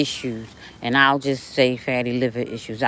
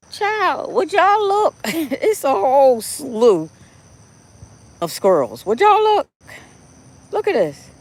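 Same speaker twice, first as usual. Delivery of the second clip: much higher, thrilled exclamations; high, excited "would y'all look"; delighted squeal; 9 semitones higher than usual. Real, not acted. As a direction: gush over the squirrels. Delighted at a swarm of squirrels.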